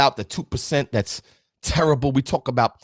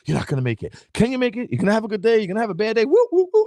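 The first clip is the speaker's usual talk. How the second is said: racing inner voices; 8 semitones higher, faster than usual. Retold or imitated. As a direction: act out the rats running in your head. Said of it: "It's like".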